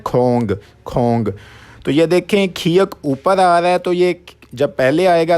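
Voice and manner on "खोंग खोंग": खोंग said slowly with a rounded o and nasal ng; slow Korean demo. Shown as a demonstration. Teacher models the Korean word for ball, slow and careful. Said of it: "गेंद को क्या कहते हैं?".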